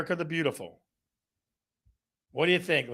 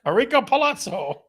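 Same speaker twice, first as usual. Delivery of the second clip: higher, louder, pleased; triumphant recall; 7 semitones higher, 4 dB louder than usual. Real, not acted. Host remembering the Naked Gun singer, triumphant. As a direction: announce the name proudly.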